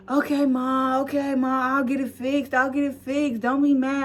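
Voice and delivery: high soothing placating; calm her down sheepishly. Retold or imitated. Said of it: "trying to just calm her down".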